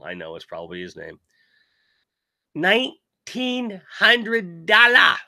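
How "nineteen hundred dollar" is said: incredulous. Real, not acted. Shocked at the statue price.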